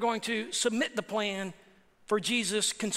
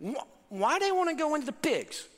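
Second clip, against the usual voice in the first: high-pitched mock puzzlement; puzzled questioner; 5 semitones higher than usual. Preacher voices people asking about the pigs, high and puzzled. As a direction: ask it in a higher, baffled voice. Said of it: "Some people ask".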